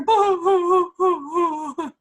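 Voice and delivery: mock sobbing boo boo; mother imitating her crying son, loud mock sobbing; imitated boo-hoo crying. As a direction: fake a child's wailing cry. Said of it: "I hear".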